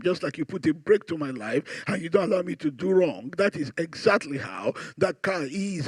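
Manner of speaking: soft, low romantic sweet-talk; emotional, tender appeal. Shown as a demonstration. Husband explaining the car gift to his wife, emotional and tender. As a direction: woo her gently with each comparison. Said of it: "moved it from rational to emotional".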